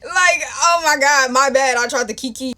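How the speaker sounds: loud, high, exasperated mock apology; over-the-top indignant "my bad"; sarcastic apology. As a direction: blurt it out, incredulous and loud. Sarcastic retort to his anger.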